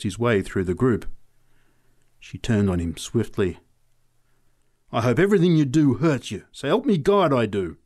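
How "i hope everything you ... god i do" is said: louder savage curse; fierce, raised voice cursing him. A narrator's reading. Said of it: "she said savagely".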